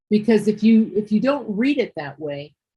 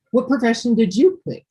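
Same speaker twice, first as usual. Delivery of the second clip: lilting question, YOU picked out high; asked as a question; 4 semitones higher than usual. Shown as a demonstration. Teacher showing how Lucas asks the question, questioning tune with stress on YOU. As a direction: read it as someone asking, lifting "you". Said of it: "Lucas is asking this".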